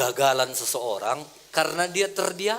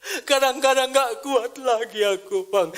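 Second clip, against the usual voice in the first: laughing while quoting; 9 semitones higher, 7 dB louder than usual. Retold or imitated. Man on phone, pastor laughing.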